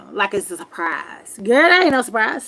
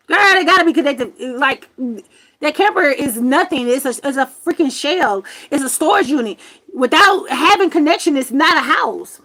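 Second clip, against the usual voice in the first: loud, higher, fast venting; heated rant; 4 semitones higher, 4 dB louder than usual. Real, not acted. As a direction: sound fed up and loud. Ranting about grandma's demand.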